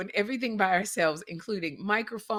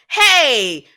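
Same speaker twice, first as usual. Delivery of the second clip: street greeting; 12 dB louder, much slower than usual. Retold or imitated. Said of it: "walks down the street and goes".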